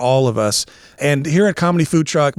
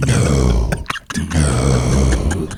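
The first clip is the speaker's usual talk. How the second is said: very deep, drawn-out demon; long, low, hellish no; demonic voice; 12 semitones lower than usual. Pretend. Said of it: "you're something demonic in my ears".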